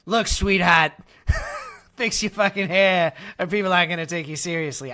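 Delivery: loud exasperated parent, half laughing; blunt loud scolding. Retold or imitated. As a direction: snap it out like a fed-up parent. Blunt talk to daughter.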